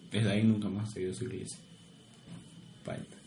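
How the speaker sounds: ordinary talk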